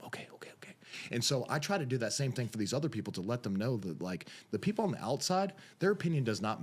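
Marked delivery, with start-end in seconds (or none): 0.0-0.7 rapid whispered okays; very soft, voiceless acting-out; whispered agreement. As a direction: whisper it as a meek reply. Kid's hushed reply, retold.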